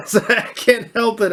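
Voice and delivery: high laugh into the words; laughing sheepishly. Real, not acted. Host laughing at himself after stumbling over a candidate's name.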